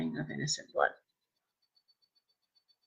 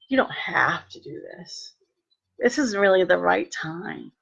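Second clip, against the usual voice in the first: Satan's whisper; 3 dB quieter than usual. Retold or imitated. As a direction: coax her gently like a lying tempter. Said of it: "You know how Satan does that".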